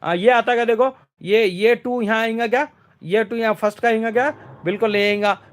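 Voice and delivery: normal talking voice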